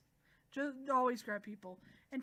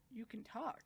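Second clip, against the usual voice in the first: softly stunned half-question; hushed surprise; 6 dB quieter than usual. Retold or imitated. Subaru hears her speak, quietly stunned. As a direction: murmur it in disbelief, softly.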